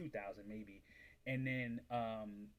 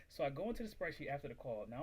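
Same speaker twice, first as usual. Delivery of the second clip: ordinary talk, nothing marked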